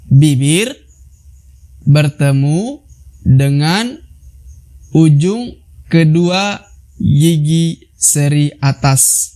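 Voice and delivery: very slow, each word spaced; slow explanation. Shown as a demonstration. Teacher slowing down to explain lip position. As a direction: lay it out slowly, word by word. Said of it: "slow explanation for students".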